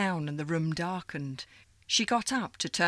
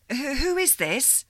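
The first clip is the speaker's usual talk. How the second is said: higher, faltering startled question; startled stammer; 8 semitones higher, 4 dB louder than usual. Acted. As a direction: stumble on the first who.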